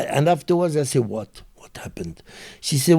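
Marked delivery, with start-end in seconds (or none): 1.1-2.2 a breathy, almost voiceless, much softer "What happened?"; feigned innocence. Retold or imitated. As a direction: play dumb, barely voiced.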